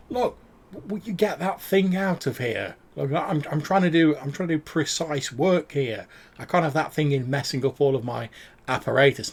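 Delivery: annoyed craftsman shooing someone out; irritated. Acted.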